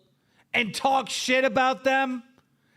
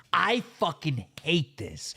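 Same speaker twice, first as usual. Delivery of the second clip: ordinary talk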